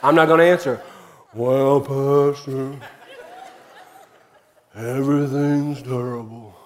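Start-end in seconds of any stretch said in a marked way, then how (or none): 1.3-6.5 mock the whiny churchgoer as Eeyore; mopey Eeyore. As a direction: drag it out like a gloomy donkey. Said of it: "If you're an Eeyore".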